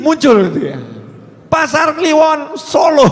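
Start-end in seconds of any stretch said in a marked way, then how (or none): 1.5-3.1 raised, drawn-out announcement; announced report. Acted. Reporting the worshippers' town to the Prophet, called out. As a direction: call out the place name slowly, pitched up. Acts out report of who is praying.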